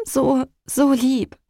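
slow and trembling, near tears. Acted. Mother clutching her rescued son, slow and trembling. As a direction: let the voice quiver as you repeat it. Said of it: "sagte ich mit zittriger Stimme".

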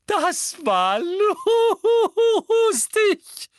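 high, loud laughing squeal; shrieking laugh. A narrator's reading. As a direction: squeal it through helpless laughter. (kreischend vor Lachen)

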